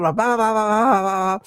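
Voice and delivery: mimicking a busy controller's nonstop patter; rattling off. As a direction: rattle it off a mile a minute. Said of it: "rattles off this clearance a mile a minute".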